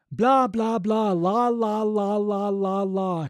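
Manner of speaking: blah and la with a slack jaw; relaxed jaw warm-up syllables; loose-jaw babble. Shown as a demonstration. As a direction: let the jaw flop on each syllable. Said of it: "jaw loosening".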